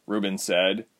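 usual speaking voice

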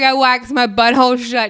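high, loud comic confession; mock panic. Client confessing a waxing mishap, mock panic. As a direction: blurt it out in hammed-up distress. Retold distress text to waxer.

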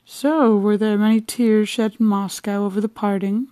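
teasing his wife with mock concern; bantering. A narrator's reading. Husband teasing his wife in mock concern, bantering. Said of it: "addressed his wife in this bantering tone".

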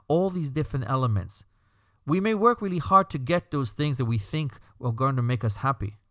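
usual speaking voice

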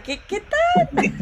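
very high, drawn-out squeaky greeting; silly high voice. Pretend. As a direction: stretch the greeting in a goofy falsetto. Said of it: "Yo aquí hablando como un idiota".

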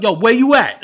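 loud, high imitation of a driver on the phone; calling out. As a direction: holler a question down the phone.

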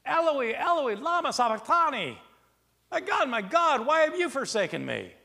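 loud, high anguished cry; a lecturer's earnest cry of anguish. Acted. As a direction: cry out to God in despair. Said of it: "he cries out".

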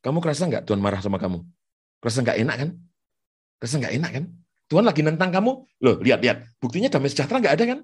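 low, needling whispered taunts from the devil; devil's accusing voice. Retold or imitated. Preacher voicing the devil's lies to the congregation, needling. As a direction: goad the listener like a sly tempter. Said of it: "Harus menipu Anda dengan berpikir begini".

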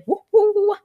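high, loud woohoo; celebratory cheer. Real, not acted. Host cheering the song's chart record, excited whoop.

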